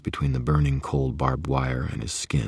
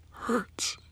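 barely a whisper, breathing the word out; a pained, breathy whisper from a broken man; whispered in agony; breathed whisper; 6 dB quieter than usual. Acted. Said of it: "he breathed at last, barely a whisper".

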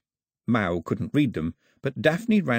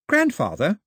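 puzzled question; 9 semitones higher, 4 dB louder than usual. A narrator's reading.

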